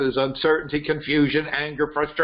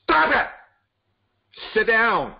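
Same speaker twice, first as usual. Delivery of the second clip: commanding shout; 9 semitones higher than usual. Shown as a demonstration. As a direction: bark it out to halt your own thoughts. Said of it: "to stop yourself when that spinning thing is going".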